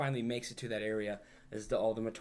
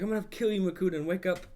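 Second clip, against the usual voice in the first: hero's challenge; 7 semitones higher, faster than usual. Retold or imitated. Hero's battle cry retold.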